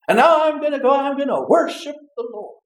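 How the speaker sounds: high, loud sulky grumble; grudgingly head into worship; grumpy sarcasm. Acted. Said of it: "You said".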